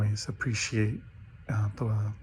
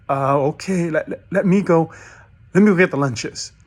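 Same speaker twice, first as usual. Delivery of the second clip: higher and louder, keen to prove himself; eager volunteering; 5 semitones higher, 6 dB louder than usual. Acted. Rookie pastor offering to fetch lunches, eager and loud.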